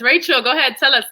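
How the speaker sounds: ordinary delivery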